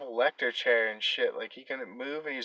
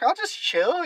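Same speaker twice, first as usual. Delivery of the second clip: casual; 4 dB louder, slower than usual. Retold or imitated. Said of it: "I was like".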